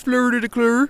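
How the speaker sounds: high, singsong Muppet chef voice; Swedish Chef. Shown as a demonstration. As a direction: pitch it way up, lilting like a Muppet cook. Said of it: "That's a pretty good Swedish chef impression".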